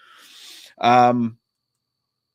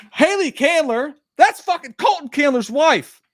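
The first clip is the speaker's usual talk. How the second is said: much higher, louder excited squeal; 8 dB louder than usual. Real, not acted. Host thrilled to spot Colton's wife in chat, excited squeal. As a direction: squeal the name out in delight. Excited reading of fan's name.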